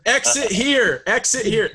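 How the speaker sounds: slow, high directing call. Pretend. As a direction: wave them toward the exit. Mock directing people to exit.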